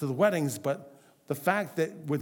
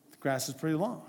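softer, casual hint with a rising end; understated hint; 3 semitones lower, 5 dB quieter, faster than usual. Retold or imitated. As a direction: lightly imitate a spouse just inferring. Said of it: "She's just inferring".

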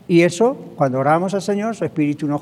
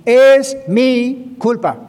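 louder and higher, very slow, "Es" hammered; proclaimed confession; 5 semitones higher, 5 dB louder, much slower than usual. Shown as a demonstration. Pastor driving the lesson home to the congregation, loud and slow. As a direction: call out "Es mi culpa" as a ringing declaration. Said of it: "repeated confession, now proclaimed".

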